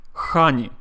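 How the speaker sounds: Russian-accented "honey" with a strong kh. Shown as a demonstration. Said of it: "A Russian person would say".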